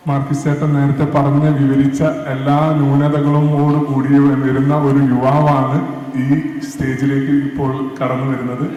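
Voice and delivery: normal talking voice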